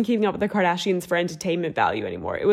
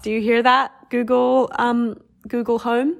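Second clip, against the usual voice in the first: louder, higher call to the Google Home; 4 semitones higher, 4 dB louder than usual. Pretend. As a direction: address the smart speaker directly. Calling to smart speaker.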